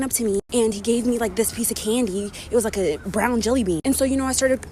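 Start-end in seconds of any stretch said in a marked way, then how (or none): none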